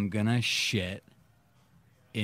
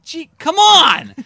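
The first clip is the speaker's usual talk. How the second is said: exasperated; 15 dB louder, slower than usual. Retold or imitated. Retold exasperation at prank.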